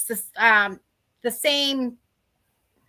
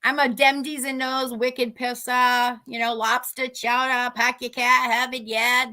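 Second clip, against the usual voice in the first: broad Boston accent, dropped R's. Shown as a demonstration. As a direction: rattle off Boston slang in a local accent. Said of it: "Boston's got an accent".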